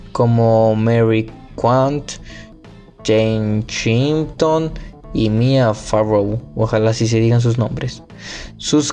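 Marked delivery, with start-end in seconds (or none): none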